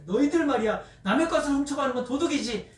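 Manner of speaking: acted indignant outburst; retold angry scolding. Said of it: "하면서 막 화를 냈어요".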